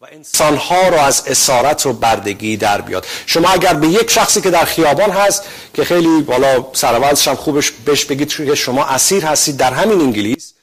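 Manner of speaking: loud, driving sermon delivery; full-force preaching. Acted. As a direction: preach it out to the whole hall. (موعظهٔ پرشور و بلند)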